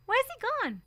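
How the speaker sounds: put-on high, alarmed child's question; lost him and anxious to know where; girl asking her mother where he went. A narrator's reading.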